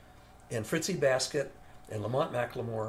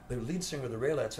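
normal talking voice

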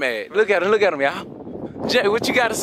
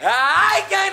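slow, drawn-out wailing lament; crying over phone; slower than usual. Acted. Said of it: "Stop crying".

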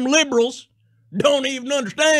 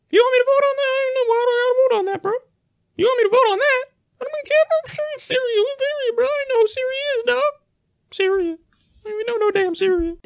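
much higher, whiny, baffled squeak; squeaky confused voice; 12 semitones higher, much faster than usual. Pretend.

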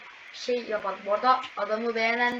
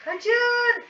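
high yelled run; panicked high shout; shouted warning; 12 semitones higher, 3 dB louder than usual. Real, not acted. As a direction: scream at everyone to flee. Warning shout while playing.

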